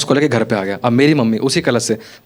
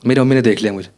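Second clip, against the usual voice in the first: ordinary delivery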